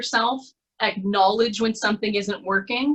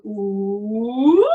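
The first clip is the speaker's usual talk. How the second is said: siren warm-up; 5 dB louder than usual. Shown as a demonstration. Voice coach demonstrates the siren exercise, rising glide. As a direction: start low and glide up, getting louder at the top. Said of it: "So you wanna start low".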